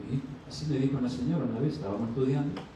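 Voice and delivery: normal talking voice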